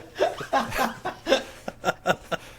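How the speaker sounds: high bursts of laughter; real laughter. Real, not acted. Friends cracking up at the shut-up joke, real laughter.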